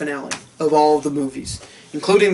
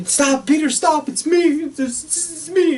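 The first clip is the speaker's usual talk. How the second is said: much higher pleading voice; pleading Harry; 11 semitones higher than usual. Retold or imitated. Goblin unmasked begging Peter, high pleading. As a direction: beg him to stop. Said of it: "and he's like".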